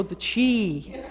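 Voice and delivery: drawn-out, hushed catchphrase imitation; Sally. Friend imitating Sally's catchphrase for the audience, drawn-out "chi". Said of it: "But Sally had to go".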